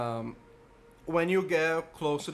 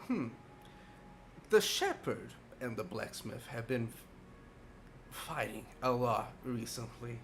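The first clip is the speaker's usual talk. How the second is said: put-on very old man; 2 semitones lower, 7 dB quieter than usual. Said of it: "a very frail old man".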